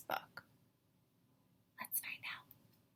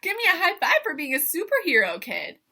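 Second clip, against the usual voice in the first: higher, louder, fast cheer; excited; 5 semitones higher, 5 dB louder than usual. Real, not acted. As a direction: hype them up.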